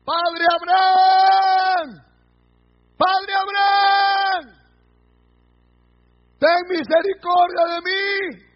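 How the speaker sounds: long, high, loud drawn-out shout; the tormented rich man shouting for mercy; crying out. Acted. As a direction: call out pleading from far below, vowels stretched. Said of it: "Entonces él dando voces dijo".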